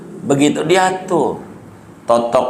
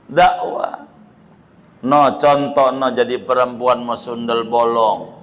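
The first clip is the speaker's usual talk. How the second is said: usual speaking voice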